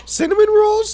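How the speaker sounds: squeaked very high in disbelief; can't believe what he hears; incredulous. Real, not acted. Reacting to toaster story.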